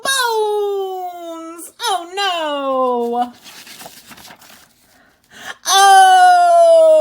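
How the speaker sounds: high, hammed-up crying wail; mock wail. Teacher wails in mock distress for the old lady, high. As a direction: pretend to despair over the bones.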